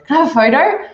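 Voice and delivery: high, eager fan asking for a photo; mock fan. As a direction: lightly mimic a pushy fan. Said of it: "The first thing they just go is".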